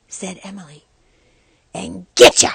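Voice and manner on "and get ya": shriek. A narrator's reading. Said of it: "Arthur shrieked".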